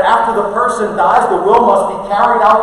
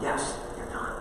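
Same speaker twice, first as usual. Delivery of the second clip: much softer, gentle answer; quiet reassurance; 7 semitones lower, 18 dB quieter than usual. Real, not acted. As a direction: tell them gently it's finished. Answers the doubter.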